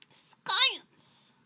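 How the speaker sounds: high, loud, booming "science"; dramatic exclamation. Acted. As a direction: announce "science" like a grand reveal. Dramatic exclamation after explanation.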